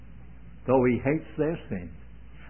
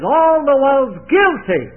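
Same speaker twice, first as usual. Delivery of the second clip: louder, higher and slow, a ringing pulpit declaration; proclaimed loudly; 9 semitones higher, 9 dB louder, slower than usual. Acted. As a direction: thunder it out to the whole church. Preacher declaring God's verdict.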